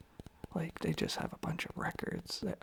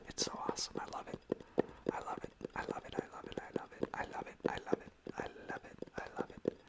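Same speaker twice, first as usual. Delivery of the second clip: repeated trigger words; 6 dB quieter, faster than usual. Acted.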